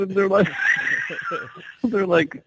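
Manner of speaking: breaking into giggles; laughing. Real, not acted. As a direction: laugh through the words.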